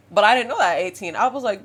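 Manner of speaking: ordinary delivery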